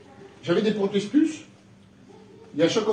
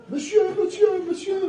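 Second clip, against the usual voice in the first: high-pitched calls of monsieur, repeated; calling out high; 11 semitones higher than usual. Retold or imitated. Angry neighbour calling after the preacher, high calls. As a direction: call him back, pitched way up.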